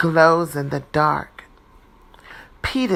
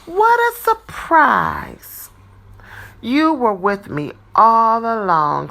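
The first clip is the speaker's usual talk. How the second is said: delighted; 4 semitones higher, 5 dB louder than usual. Acted. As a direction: savour the happy surprise. Pete delighted.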